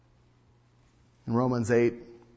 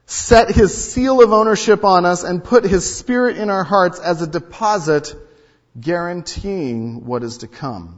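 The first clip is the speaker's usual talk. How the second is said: preaching scripture; 5 semitones higher, 10 dB louder than usual. Acted. Pastor reading Corinthians to the church, preaching loud. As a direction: read it out like a pulpit.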